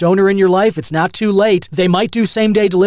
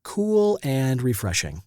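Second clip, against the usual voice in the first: slow, soft, exhaling smoke; after a bong hit; 5 semitones lower, 9 dB quieter than usual. Real, not acted. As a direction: talk while breathing out smoke. Right after a long bong hit.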